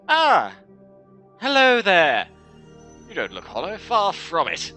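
ordinary talk, nothing marked

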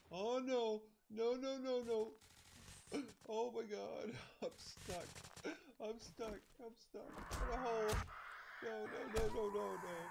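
high, loud frantic no-no-nos; real panic as the bike sinks; yelping in dismay; panicked. Real, not acted. Bike stuck in a hole.

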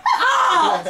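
high yelp through laughter; mock pained yelp. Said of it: "I'll be like".

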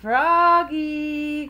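high, loud singsong call; dad calling. A narrator's reading. Dad calls Froggy for the play date, calling out. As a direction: call the name out long. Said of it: "called Dad".

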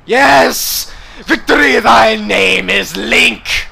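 loud, slow victory cry; triumphant shout. Acted. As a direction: gloat over the win. Triumph after boss win.

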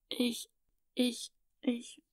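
timid whispered stammering; hesitant stammer. Acted. Little girl afraid to confess to father, stammering. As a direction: barely dare to get the words out. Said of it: "druckste die Kleine".

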